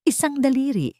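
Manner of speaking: ordinary delivery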